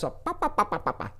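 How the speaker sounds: high squeaky chipmunk babble. Retold or imitated. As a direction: mimic a chattering chipmunk. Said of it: "a little chipmunk voice".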